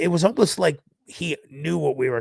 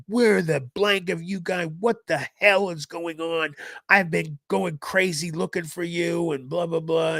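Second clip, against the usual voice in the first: retold frantic scolding, higher voice; angry scolding dad; 6 semitones higher than usual. Friend's dad confronts the kids coming in late, mock-angry rant. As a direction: imitate an exasperated dad letting fly.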